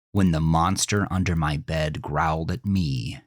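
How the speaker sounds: ordinary delivery, nothing marked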